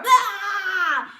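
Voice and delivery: loud roaring bah, tongue out; lion roar. Shown as a demonstration. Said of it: "shake and roar".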